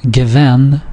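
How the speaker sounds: Gewen stressed on its last syllable; final-syllable stress. Shown as a demonstration. Teacher showing another exception, final-syllable stress. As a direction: put the weight on "wen" in Gewen. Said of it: "again the last syllable".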